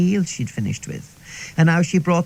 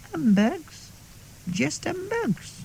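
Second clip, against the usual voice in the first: deadpan innocence; 10 semitones higher, 7 dB quieter, slower than usual. Retold or imitated. Pupil's deadpan reply.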